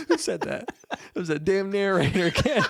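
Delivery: high, laughing jokey voice. Real, not acted. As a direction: giggle out the joke. Joking about the narrator.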